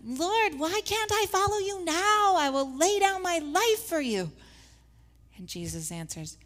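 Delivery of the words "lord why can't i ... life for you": high, loud earnest pleading; hammed-up devoted follower protesting; eager Simon. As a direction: plead like an impulsive disciple. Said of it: "Then Simon".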